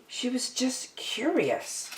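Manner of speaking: soft sympathetic aside. A narrator's reading. Narrator softly excusing Ariel's curiosity. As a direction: ease the line out tenderly.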